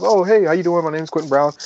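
retelling his overeager first hello; eager greeting. Retold or imitated. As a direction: greet the senior guy like a keen rookie. Eager newbie greeting, retold.

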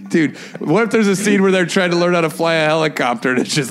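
usual speaking voice

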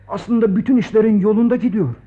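ordinary talk, nothing marked

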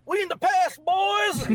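high, loud screechy robot shout; Starscream impression. Friend mimicking Starscream's gleeful shout about the past. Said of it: "how quick Starscream is to be like".

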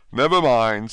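ordinary delivery, nothing marked

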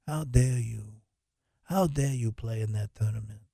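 a mock Godfather, quietly offended; mock don scolding a traitor; bad Corleone impression. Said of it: "my bad, uh, Corleone impression".

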